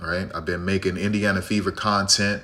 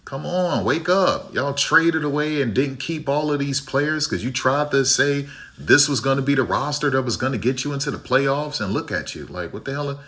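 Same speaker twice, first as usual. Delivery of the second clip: higher, mock yelling fans telling the team to wake up; mock frustrated fans; 6 semitones higher than usual. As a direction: play a fed-up fan heckling the team, pitched high. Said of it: "The fans are like".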